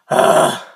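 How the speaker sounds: hard breathy growl; growled sigh. Shown as a demonstration. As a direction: push the sigh from the diaphragm. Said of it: "Start off with doing the sigh".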